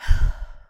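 long, breathed-out sigh; heavy sigh. Acted. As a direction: let out a composing breath. Reins in emotion.

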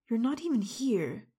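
ordinary talk, nothing marked

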